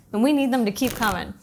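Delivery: ordinary talk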